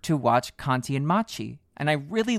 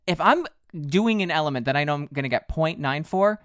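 normal talking voice

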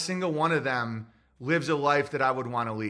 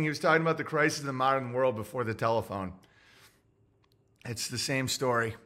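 usual speaking voice

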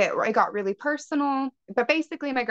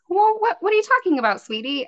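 higher, quicker, lightly voiced puzzled teacher; mock teacher voice; 11 semitones higher, 3 dB louder than usual. Guest imitating her teacher's confused reply, lightly voiced. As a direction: play the teacher's puzzled brush-off, a bit higher.

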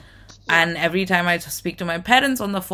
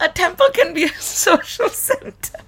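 talking through laughter, pitched up; laughing; 12 semitones higher than usual. Real, not acted. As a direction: giggle while comforting. Laughing while reassuring her.

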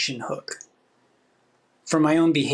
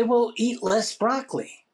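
matter-of-fact advice; 6 semitones higher than usual. Retold or imitated. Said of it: "You say".

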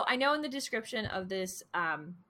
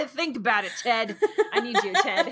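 laughing; 3 semitones higher, 8 dB louder than usual. Real, not acted. Laughing mock appeal to Ted.

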